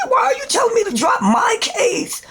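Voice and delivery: high, shouted demand; furious shout. Retold or imitated. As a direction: yell it at her in outrage. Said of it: "I'm like".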